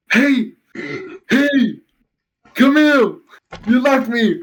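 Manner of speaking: high-pitched retold call from the dark; distressed calling out. Friend left in the dark calls after him, high-pitched plea. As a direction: imitate someone left behind calling out.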